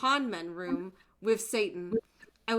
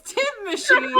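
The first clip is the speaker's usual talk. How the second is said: excited squeal; 14 semitones higher, 9 dB louder than usual. Acted. Friends voicing dramatic reading.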